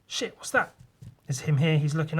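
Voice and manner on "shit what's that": startled high cry. Acted. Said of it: "He looks up".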